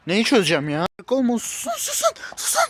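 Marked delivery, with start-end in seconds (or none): 1.1-2.6 shrill rising cry, much higher; desperate cry. Acted. Alone in the dark, crying out at voices in his head. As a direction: beg the voices to stop, pitch climbing.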